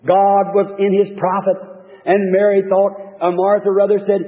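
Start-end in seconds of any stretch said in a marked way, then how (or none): none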